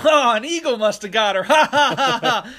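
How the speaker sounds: loud, high laughing jest; imitated joking. Relatives joking about missing dog, loud mock laughter. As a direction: crack the joke and cackle loudly. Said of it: "joking and shit".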